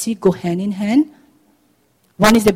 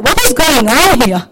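mildly indignant, raised-volume question; angry older son; 4 semitones higher, 6 dB louder than usual. Acted. Older son asking his servant what's happening, indignant. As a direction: confront the servant, louder. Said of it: "He was angry".

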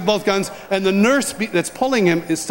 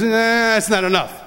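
playful refusal; 4 semitones higher than usual. Retold or imitated. Retold father refusing to let go.

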